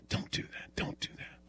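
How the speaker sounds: hushed, urgent whisper; whispered scolding. Retold or imitated. Wife to husband posing on tiptoes, whispered scolding. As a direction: imitate a wife's whispered warning.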